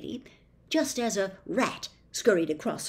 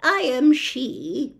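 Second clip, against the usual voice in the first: louder, slow calm admission; casually; 6 semitones higher, 7 dB louder, slower than usual. A narrator's reading. The old lady admitting she's the witch, slow and casual. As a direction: say it like nothing. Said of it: "the old lady answered casually".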